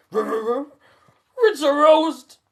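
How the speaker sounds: high, loud, very slow Scooby-Doo yelp. A narrator's reading. Scooby yelping at the ghost, loud and dragged out. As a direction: yelp it like a scared cartoon dog. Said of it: "Scooby yelps".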